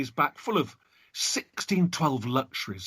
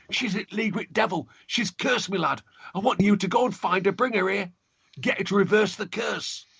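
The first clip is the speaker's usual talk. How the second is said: frightened father; 6 semitones higher than usual. Acted. Cursed father pleading with son.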